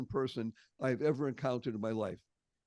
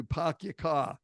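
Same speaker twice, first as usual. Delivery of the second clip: "pahk yah cah" in Boston style; Boston accent; slower than usual. Shown as a demonstration. Native giving the classic example, Boston accent. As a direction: drop the r's like a Bostonian in park and car. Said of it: "that's a Bostonian accent".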